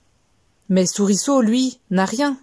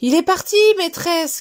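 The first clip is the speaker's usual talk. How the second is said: high shouted call to the teacher; children calling out; 9 semitones higher than usual. Acted. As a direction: call out that it's gone. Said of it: "crient les enfants".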